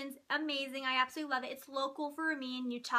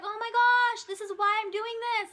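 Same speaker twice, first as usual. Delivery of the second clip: excited realization; 6 semitones higher, 5 dB louder than usual. Retold or imitated. Her own excited realization retold.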